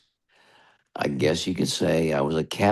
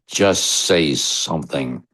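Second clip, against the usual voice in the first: mock angry order; slower than usual. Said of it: "he got really angry".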